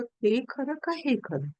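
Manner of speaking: pondering question. Retold or imitated. King to the summoned physicians, pondering question. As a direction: repeat the riddle slowly, absorbed in it.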